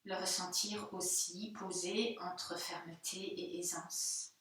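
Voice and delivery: soft, hushed meditation voice with spaced phrases; guided meditation. Real, not acted. Yoga teacher after the Om chant inviting students to observe their body, hushed meditative calm. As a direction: guide them softly through sensing the body, leaving room for silence.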